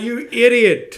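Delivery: loud, high mock insult; pretend insult. A friend suggesting what to shout back, loud and high. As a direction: hurl the insult with a mock yell.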